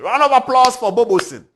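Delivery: loud, high hailing call; excited shout. Real, not acted. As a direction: hail him like a crowd-rouser. Host hailing new minister.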